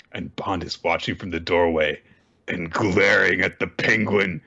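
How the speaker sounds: ordinary delivery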